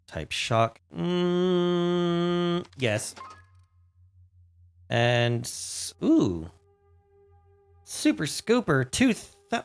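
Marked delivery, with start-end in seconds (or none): none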